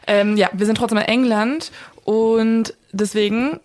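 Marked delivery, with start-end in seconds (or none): none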